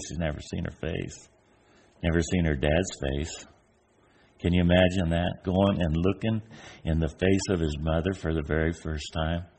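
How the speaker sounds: ordinary talk, nothing marked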